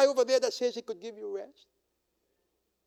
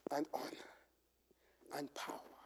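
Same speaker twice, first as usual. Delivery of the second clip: whispered praise; 5 semitones lower, 19 dB quieter than usual. Acted. Heavenly chorus of praise quoted.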